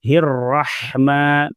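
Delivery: الرحمن slow, with the ha clearly breathed; ha kept audible. Shown as a demonstration. Teacher showing students the ha sound, slowly. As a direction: let the ha in الرحمن be heard. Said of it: "ہا کی آواز کو باقی رکھنا ہے".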